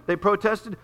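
ordinary delivery

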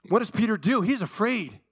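usual speaking voice

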